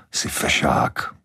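slow, breathy whisper; whispered compliment. A narrator's reading. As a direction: whisper the praise to him. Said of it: "Ellen ho pochválila". (Ellen šeptem chválí manžela)

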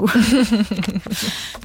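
short real chuckle after a story; amused laugh. Real, not acted. Amused after reading the actress's telephone story, short laugh. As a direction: laugh lightly at the anecdote.

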